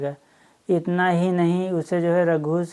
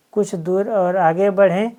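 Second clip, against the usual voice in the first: usual speaking voice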